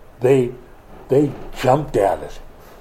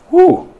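high whistle-like whew; 8 dB louder than usual. Real, not acted. Reaction after the reading.

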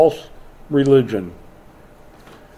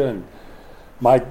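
ordinary talk

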